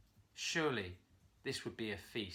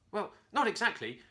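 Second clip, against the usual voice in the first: embarrassed; 9 semitones higher, 7 dB louder than usual. A narrator's reading. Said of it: "the monkey said in embarrassment".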